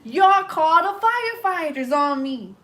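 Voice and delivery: put-on high, slow, pissed-off drunk voice; imitating an angry drunk. Retelling how the drunk man yelled at the firefighters, high and angry. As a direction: mimic the drunk's outrage, dragged out. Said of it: "That man was so pissed".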